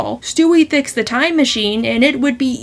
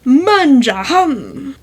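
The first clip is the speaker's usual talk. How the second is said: slow, hammed-up Italian word; Italian accent; much slower than usual. Shown as a demonstration. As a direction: drag out "Manja hum" like an Italian. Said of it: "one or two more Italian words".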